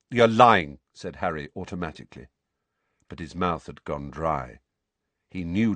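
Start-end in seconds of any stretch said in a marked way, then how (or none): 0.1-0.8 loud sharp retort; sharp denial. A narrator's reading. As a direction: fire it back instantly.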